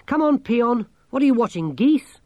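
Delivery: high, loud urging; impatient call. A narrator's reading. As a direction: chivvy a distracted companion. Calling out to distracted companion.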